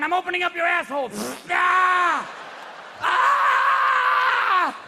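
high shouted threat into a scream; threaten, then let out a long mock scream; threatening heart. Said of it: "the heart's going".

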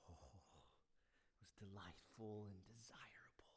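zacht, langzaam gefluisterd; whispered temptation. Acted. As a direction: fluister het verleidelijk. Voicing Eve's temptation.

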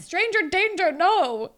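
playful high-pitched alarm; mock alarm warning. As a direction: imitate an alarmed friend calling out danger. Voicing Jenna's alarm about the coach.

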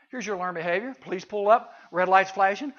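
ordinary delivery

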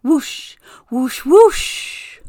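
high, building loud whooshes; gusting whoosh. A narrator's reading. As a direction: blow the hat away. Narrator voices gusting wind.

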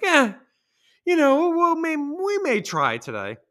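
high, lazy, can't-be-bothered shrug; mock teen apathy, drawn out; indifferent kids. Bored kids shrugging off sports practice, high and lazy. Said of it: "they're just like".

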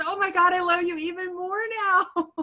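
high, wobbly, almost crying; tearful gush. Retold or imitated. Patient moved to tears by her therapist, crying high. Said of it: "she goes".

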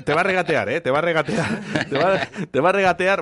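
giggling, rising teasing repeat; laughing tease. Real, not acted. Teasing about haggling, laughing.

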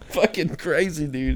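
ordinary talk

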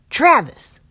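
bellowing his partner's name; yelling. Acted. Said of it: "the other man yelled".

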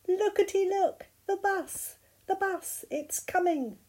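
high-pitched, excited storybook exclamations; excited cry. A narrator's reading. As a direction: cry out as Rabbit spotting the bus. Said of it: "cried Rabbit".

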